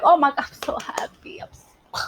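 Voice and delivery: mock whisper. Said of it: "if you like".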